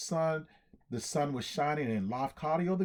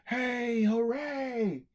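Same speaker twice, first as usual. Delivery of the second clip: high cheers from a happy crowd; crowd cheering; 8 semitones higher, much slower than usual. Acted. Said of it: "the crowd kept yelling".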